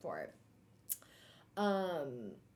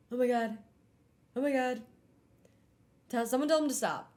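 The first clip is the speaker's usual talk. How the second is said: louder, higher cringing outburst; acted-out horrified cringe; mortified plea; 5 semitones higher, 4 dB louder, faster than usual. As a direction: beg for it to stop, hammed-up. Acting out her cringing reaction.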